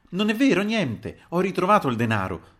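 loud, high-pitched denial; vehement cry. A narrator's reading. Narrator denies the theft to the landlady, crying out. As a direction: cry it out to put a stop to the rumour. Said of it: "gridai alla caporale".

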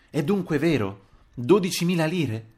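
distraught questioning. A narrator's reading. Landlady rushes up to the narrator about the money, distraught. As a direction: ask it in upset alarm. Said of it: "tutta sconvolta".

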